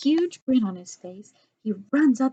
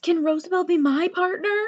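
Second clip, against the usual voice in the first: put-on high, loud, eager child's plea; 10 semitones higher, 6 dB louder than usual. A narrator's reading. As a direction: beg the teacher excitedly. Eager child asks teacher.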